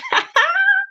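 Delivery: short high laugh; amused laugh, pitched right up. Real, not acted. Amused by her own pun.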